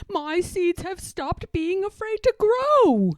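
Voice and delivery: loud, high, joyful; shouting. A narrator's reading. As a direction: keep the triumphant shout going. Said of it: "shouted Toad".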